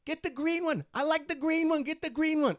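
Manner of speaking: put-on high excited child voice; kid's voice. Son begging dad for the green cat, high childlike voice. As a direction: imitate the kid begging for the green one. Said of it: "He's like".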